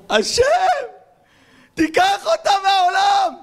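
long, high, drawn-out wailing shout; anguished shouted prayer. Acted. Bitter man begging God to take her, slow high wail. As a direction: wail it up to heaven, slowly.

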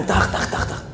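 quick unpitched "tach" clap noises; imitated clapping. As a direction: mimic applause with the mouth. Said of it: "שומע כפיים". (חיקוי קולי של מחיאות כפיים)

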